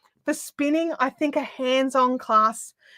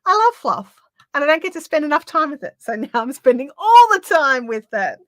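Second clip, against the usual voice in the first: higher, louder, delighted gushing with a laugh; happy; 4 semitones higher, 7 dB louder than usual. Real, not acted. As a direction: gush happily about what you love, pitch up. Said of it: "You sound so happy talking about it".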